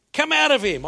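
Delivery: high, loud yelled order; sudden shout casting out a spirit; screamed command. Acted. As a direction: yell it out as a fierce command. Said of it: "I screamed".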